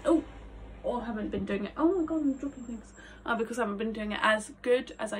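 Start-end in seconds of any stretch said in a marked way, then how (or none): none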